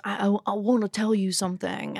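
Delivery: lightly imitated drunk bro; a hint of a drunk party guy's voice. Drunk bachelor-party guest approaching a woman, lightly mocked bro voice. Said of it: "It's a good bro voice".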